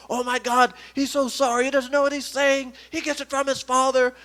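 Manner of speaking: high, hurried apology; flustered apologetic mom. Retold or imitated. Mom runs up to excuse her son, high and flustered. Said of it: "his mom comes running up".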